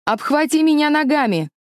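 high, loud urgent yell; shouting. Acted. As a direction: bellow it over the wind. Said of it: "прокричал он".

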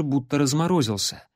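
ordinary delivery

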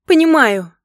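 loud, high, angry single word; seething retort. A narrator's reading. Wife pushing her husband away, seething retort. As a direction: fire it back at him. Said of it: "процедила я".

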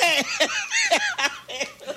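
a high, joyous burst of laughter. Real, not acted. Preacher delighting in his own story, high laughter. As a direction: let the laugh fly up high.

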